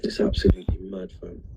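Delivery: breathy hushed whisper, nearly no voice. Real, not acted. Grieving friend telling his live stream it's mad, whispered. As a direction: let it drop to a stunned whisper.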